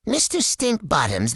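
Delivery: usual speaking voice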